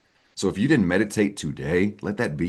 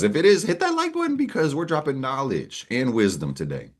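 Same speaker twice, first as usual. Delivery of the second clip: hyped announcer; 8 semitones higher than usual. Pretend. Hyped call to like button.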